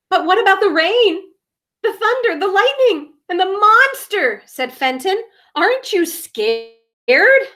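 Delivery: high, anxious string of worries; scared questions. Acted. Fenton asks Bradley if he is scared, anxious. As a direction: fret about every danger.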